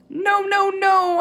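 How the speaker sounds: very high, loud squeaking no; terrified hamster squeaking refusal; panicked squeak. A narrator's reading. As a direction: squeal it in horror at the idea. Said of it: "I squeaked".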